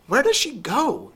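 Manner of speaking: put-on a high, curious little girl; Sophie wondering. A narrator's reading. Curious girl asking about her aunt, high and eager. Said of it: "Sophie wondered".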